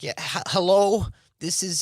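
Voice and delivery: high nasal put-on caller voice, lightly done.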